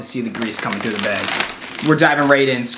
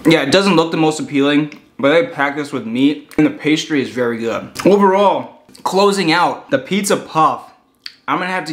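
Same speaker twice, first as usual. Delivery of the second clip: chewing while talking; muffled words around food; mouth full. Real, not acted. Seen 3: Cheeks bulge with food while holding bitten pastry, talking.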